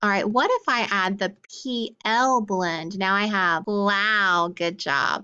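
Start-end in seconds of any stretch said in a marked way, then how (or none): none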